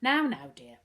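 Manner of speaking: mother checking her upset son; a little sharp. A narrator's reading. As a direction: cut him off firmly. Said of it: "said a little sharply".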